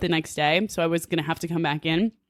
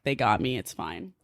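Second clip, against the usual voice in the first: soft, much lower, sighing relief; relieved sigh; 9 semitones lower, 6 dB quieter than usual. Retold or imitated. Girl at the salon after hearing good news, relieved sigh. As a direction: let the breath out, relieved. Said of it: "breathe a sigh of relief".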